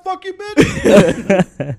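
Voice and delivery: high, loud, very drawn-out taunt; copycat kid. Acted. Classmate repeating the swear, copycat kid voice. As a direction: imitate a kid gleefully repeating it. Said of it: "always have that kid who said".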